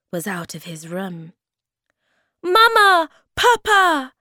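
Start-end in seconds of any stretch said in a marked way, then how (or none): 2.4-4.1 high, loud calls; shouting. A narrator's reading. Moomin Troll climbing stairs to parents, high shout. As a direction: call for parents at full voice. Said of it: "shouted Moomin Troll".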